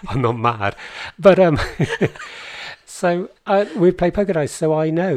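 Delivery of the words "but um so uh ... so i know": laughing. Real, not acted. Laughing at imaginary friends remark.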